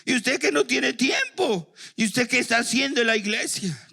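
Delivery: high, scornful, laughing jab; mocking church gossip. Church member mocking another for lacking time, scornful and high. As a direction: needle a fellow member with a sneer. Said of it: "Y dentro de la iglesia".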